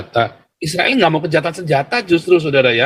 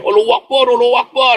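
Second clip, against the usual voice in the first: higher, louder mock chant of Allahu Akbar; retold shouting; 7 semitones higher, 4 dB louder than usual. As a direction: imitate them shouting it out. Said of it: "Mereka sambil teriak".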